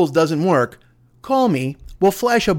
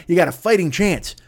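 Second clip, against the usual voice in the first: ordinary talk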